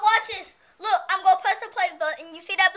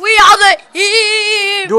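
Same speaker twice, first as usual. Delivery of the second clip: villain voice; 12 dB louder than usual. Pretend. Answering evil laugh request.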